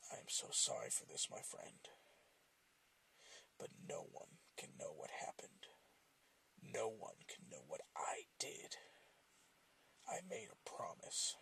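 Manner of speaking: a menacing hushed whisper of a promise kept; spoke quietly. A narrator's reading. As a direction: whisper the apology over what you must do. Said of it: "The puppet spoke quietly".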